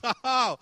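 loud, high "ha ha" of scorn; triumphant mocking laugh; malicious laugh. God laughing at our enemy, malicious laugh. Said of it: "with a malicious humor".

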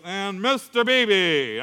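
drawn-out roll-call summons; teacher calling on student. Retold or imitated. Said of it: "and he goes".

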